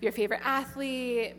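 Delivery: ordinary talk, nothing marked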